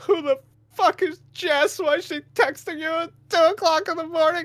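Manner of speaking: very high-pitched mock female voice; crying girlfriend imitation. Girlfriend under bed about texts, imitated crying accusation. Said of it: "laying on the floor under the bed, crying".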